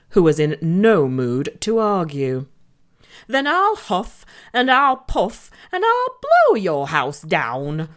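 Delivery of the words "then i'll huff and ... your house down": lightly voiced wolf, slowing at the end; wolf threat. A narrator's reading. Wolf threatens the pigs, menacing. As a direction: growl out the threat. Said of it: "threatened the wolf".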